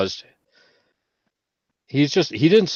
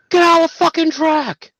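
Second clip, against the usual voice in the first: high, loud furious yell; retold scream, done at full force; 6 dB louder than usual. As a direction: bellow it at the clumsy gunny. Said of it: "scream at him".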